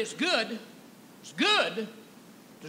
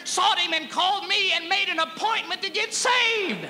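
preaching at full force; 10 semitones higher, 7 dB louder than usual. Real, not acted. Preacher shouting joy over grace to the congregation.

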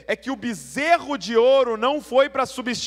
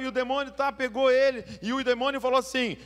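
ordinary delivery

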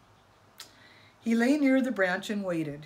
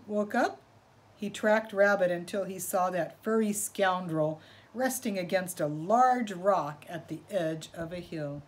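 usual speaking voice

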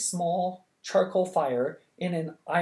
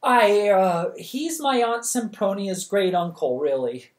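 louder, higher, hesitant admission; girl confessing to the king, flustered; nervous confession; 5 semitones higher, 5 dB louder than usual. A narrator's reading. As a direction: own up awkwardly. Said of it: "Charmaine confessed".

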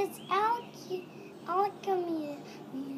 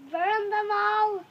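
normal talking voice

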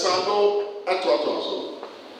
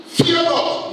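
booming divine voice; 11 semitones higher, 3 dB louder than usual. Acted. Preacher voicing God in vision.

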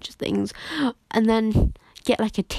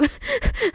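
high-pitched giggle burst; high squeal laugh. Real, not acted. Gamer laughing at own craving, high giggle.

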